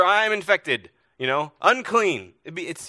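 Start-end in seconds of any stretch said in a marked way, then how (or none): 0.0-0.9 high, loud announcement; yelling. Acted. Sick person announcing infection to others, yelling. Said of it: "you had to yell". 1.6-2.3 high, loud cry of warning; yelling. Acted. Leper warning people as they approach, yelling. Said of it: "you had to yell".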